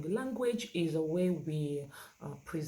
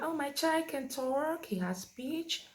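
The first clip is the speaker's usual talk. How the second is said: higher, drawn-out voice of an insistent parent; proud parent; 10 semitones higher, slower than usual. Acted. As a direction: play a parent proudly reporting. Parent boasting about child's speech.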